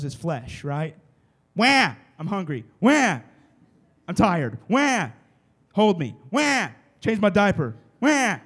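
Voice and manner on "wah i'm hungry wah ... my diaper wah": put-on loud, high baby cries between needy demands; mock baby wails. Pastor imitating a baby crying for its needs. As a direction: wail like a hungry baby.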